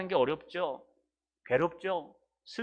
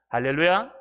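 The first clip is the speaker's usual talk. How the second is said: 할렐루야 called out louder, rising at the end; called hallelujah; 7 dB louder than usual. Shown as a demonstration. Pastor calling the congregation to answer, loud and rising. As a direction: call 할렐루야 out to the hall, lifting it. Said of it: "할렐루야 repeated".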